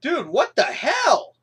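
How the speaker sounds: slow, loud disbelief; dude, what the hell, shouted slowly; outraged shout. Real, not acted. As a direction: yell it in disbelief. Outraged at Fluttershy's act.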